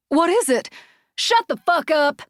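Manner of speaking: high, loud panicked yelling; frantic shout. A narrator's reading. Startled woman shouting at the laugh. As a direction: she screams at the laughing voice above. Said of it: "she shouted".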